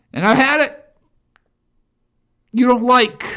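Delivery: real high, loud shout; fed-up outburst. Real, not acted. Host telling critics he has had it, fed-up shout. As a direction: burst out loudly, at the end of patience.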